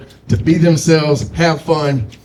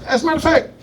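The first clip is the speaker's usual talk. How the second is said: punchline; 14 semitones higher, much faster than usual. Acted. Punchline escalation.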